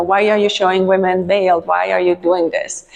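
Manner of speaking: normal talking voice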